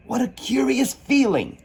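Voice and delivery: put-on high, wondering girl's voice; young Alice. Alice shrinking, high and wondering. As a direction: marvel at the strange feeling.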